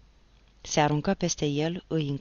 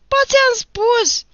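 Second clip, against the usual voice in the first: far higher, louder and slow shriek; exasperated shriek; 13 dB louder, much slower than usual. Acted. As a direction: lose his temper and scream it. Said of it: "țipă Nicolae scos din sărite". (țipăt exasperat, foarte ascuțit și lent)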